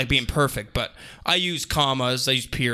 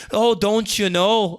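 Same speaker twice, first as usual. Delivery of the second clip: slow, much higher and louder Minnesota voice; Minnesota accent; 7 semitones higher, 5 dB louder, slower than usual. Retold or imitated. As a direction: stretch it out like a friendly Minnesotan. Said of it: "the Minnesota one".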